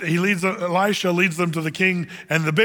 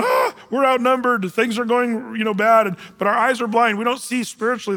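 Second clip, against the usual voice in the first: higher-pitched mock panic; freaking out; 5 semitones higher than usual. Preacher voices believers who feel outnumbered, in mock panic. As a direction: act out a fretful freak-out, opening on a raised "Ah". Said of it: "you and I are freaking out".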